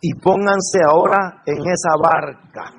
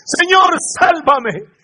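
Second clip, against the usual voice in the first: high, loud panicked plea to be saved; desperate cry for help; 11 semitones higher, 4 dB louder, slower than usual. A narrator's reading. Peter sinking in the storm cries out to Jesus, high and loud with panic. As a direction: cry out like a man sinking in the waves. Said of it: "comenzaba a hundirse y gritó".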